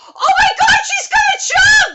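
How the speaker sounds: very high, loud, stretched-out alarm; panicked shriek. Real, not acted. Viewer realizes heroine will jump, panicked shriek. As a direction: cry out in real shock.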